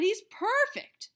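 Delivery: high, loud, slow delight; excited cry. A narrator's reading. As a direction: savor the discovery. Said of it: "he cried".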